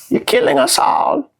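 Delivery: very high, mocking falsetto; hammed-up young activist scolding, drawn out; slow, strained falsetto plea in mockery; Greta Thunberg. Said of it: "That's how she sounds".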